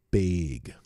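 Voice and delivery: bag said with a long A like "bayg"; wife's long-A bag. Retold or imitated. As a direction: imitate her saying bag with a long A. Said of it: "with a long A".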